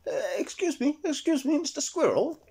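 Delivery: timid high elf asking nicely; polite Eric. Acted. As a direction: ask sweetly like a shy small elf. Eric calls up the tree.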